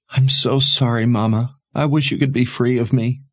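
high, pleading, guilt-laden voice; tearful apology. A narrator's reading. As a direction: plead as if overcome by tears. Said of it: "Her tears flooded forth".

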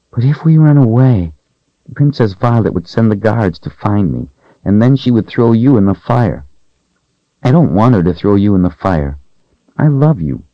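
put-on a frightened young servant girl, hushed and hoping. Voices young Rachel in story.

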